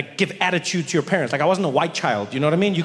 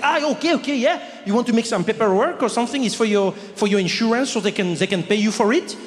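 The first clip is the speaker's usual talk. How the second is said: sympathetic clerk; 6 semitones higher than usual. Retold or imitated. Paris clerk to the comedian about lost luggage, high overdone sympathy.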